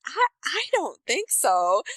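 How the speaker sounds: drawn-out, high-pitched hesitant no; hesitant refusal. Retold or imitated. Woman declining the doctor's misheard request, hesitant and high. Acted reply in retold awkward story.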